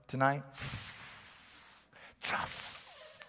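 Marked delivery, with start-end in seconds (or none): 2.2-3.2 breathy whispered hypnotic snake hiss; Kaa the snake. Retold or imitated. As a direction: hiss it like the Jungle Book snake luring you in. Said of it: "Jungle Book".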